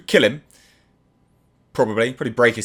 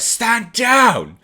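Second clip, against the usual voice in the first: high, loud Superman-style command; acted-out angry yell; angry shout; 13 semitones higher, 4 dB louder than usual. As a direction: shout it like a furious order. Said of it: "you see he's angry".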